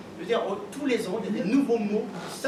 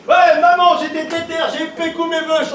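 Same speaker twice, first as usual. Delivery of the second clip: high, loud cocky youth; slang teen voice; 8 semitones higher, 10 dB louder than usual. Retold or imitated. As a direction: shout it like a teen to mum. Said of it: "il dit".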